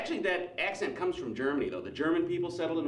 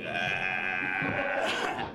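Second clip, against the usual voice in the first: long, low zombie moan; mock zombie drone; zombie groan; 7 semitones lower than usual. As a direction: groan like the walking dead. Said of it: "like walking through a Dawn of the Dead movie".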